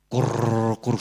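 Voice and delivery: rolled-r growl of an upset stomach; imitated stomach rumble. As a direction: make a low gurgling stomach noise. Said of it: "suena aquí el estómago muy mal". (imitando tripas que rugen)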